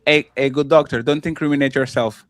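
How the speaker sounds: ordinary delivery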